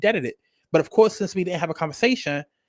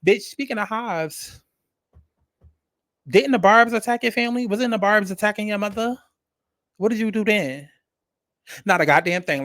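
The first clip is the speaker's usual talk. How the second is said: call-out; 3 semitones higher than usual. Real, not acted. Calling out [name].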